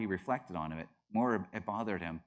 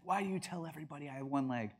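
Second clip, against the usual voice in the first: higher, softer, quick mock complaint; a hint of mom's protest, pitched up and hurried; lightly voiced complaining mom; 5 semitones higher, 5 dB quieter, faster than usual. Mom objecting to her son's story, lightly mimicked complaint.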